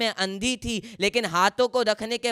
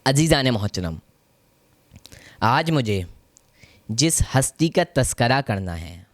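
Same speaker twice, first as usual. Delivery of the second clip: solemn majlis address; 5 semitones lower than usual. Acted. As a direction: lower voice, let each phrase sink in before the next. Opens a new majlis address.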